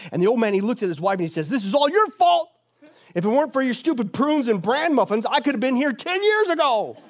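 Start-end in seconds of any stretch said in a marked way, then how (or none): 1.5-7.0 high, shrieking mock tantrum; fit of anger. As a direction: shriek the blame in hammed-up fury. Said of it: "he goes into a fit of anger".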